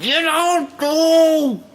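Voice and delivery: slow, high, loud yell; hammed-up shriek; mock scream. Imitating the screaming man again.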